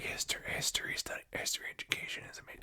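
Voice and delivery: quick hushed whisper; whispered aside. Real, not acted. Host whispering a dig at history majors, fast sly whisper.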